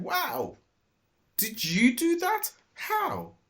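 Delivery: high astonished owner; amazed. A narrator's reading. Owner finds the caught robbers, high amazed voice. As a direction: marvel at what she did.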